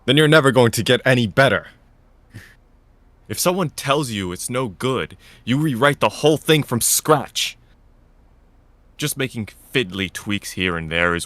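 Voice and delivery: loud, fast, scolding; stern reprimand. Acted. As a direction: tear into her, loud and quick. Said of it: "cringing at the reprimand".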